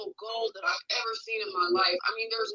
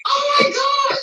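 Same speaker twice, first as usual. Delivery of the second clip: high, loud excited cry; excited friend; 9 dB louder, slower than usual. Retold or imitated. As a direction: squeal it like a thrilled friend. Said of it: "She's so excited".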